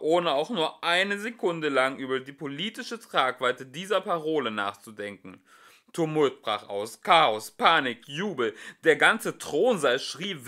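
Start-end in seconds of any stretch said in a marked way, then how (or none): none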